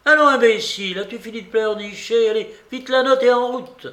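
put-on high, loud, impatient old-lady orders; angry scolding. A narrator's reading. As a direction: cut off the whimpering with loud commands. Babouchka scolds the whimpering servant. (babouchka qui rabroue sa servante)

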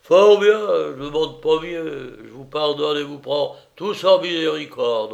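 loud, high, grand tipsy pardon; drunken master, majestic. A narrator's reading. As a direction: grant forgiveness with boozy pomp.

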